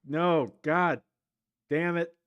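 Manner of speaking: loud exasperated curse; frustrated outburst. Real, not acted. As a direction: blurt it out in frustration. Opponent's combo wrecks him.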